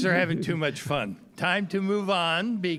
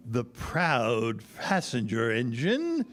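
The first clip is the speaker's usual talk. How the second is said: usual speaking voice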